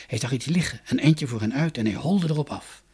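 ordinary delivery

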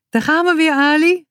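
high, called-out cheer; calling out. A narrator's reading. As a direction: shout it to Ali while running. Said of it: "riep Boutje". (hoog en roepend naar Ali)

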